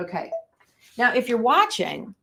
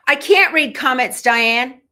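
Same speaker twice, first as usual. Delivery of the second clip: calling out; 4 semitones higher, 7 dB louder than usual. Real, not acted. Laughing defense to live commenter.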